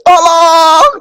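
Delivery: very high, drawn-out holler; imitated child's scream. Toddler begging strangers for help, screaming. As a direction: yell for rescue at full force. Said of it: "dia teriaknya tuh saya nggak pernah".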